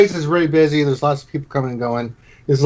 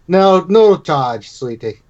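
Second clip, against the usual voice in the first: tender innkeeper; 4 semitones higher, 4 dB louder, much slower than usual. Acted. Innkeeper telling the little girl the pastry is free, tender. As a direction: gently wave away payment.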